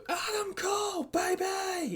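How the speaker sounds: very high, drawn-out crowd chant; strained high mock shout, held back in volume; imitated crowd shout. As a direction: mimic a whole crowd yelling the catchphrase. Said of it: "watching a crowd go".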